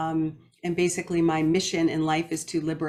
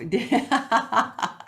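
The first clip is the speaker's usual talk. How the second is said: bright burst of real laughter; amused chuckle; 5 semitones higher than usual. Real, not acted. Host recalls hummingbird taking off, amused chuckle. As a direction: laugh freely at the little memory. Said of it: "it gave me a little chuckle".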